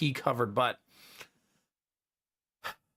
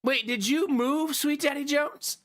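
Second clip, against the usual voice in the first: mock surprise; 11 semitones higher, slower than usual. Mock-surprised reading chat name.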